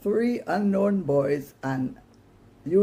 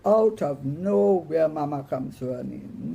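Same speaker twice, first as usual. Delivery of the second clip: plain reading voice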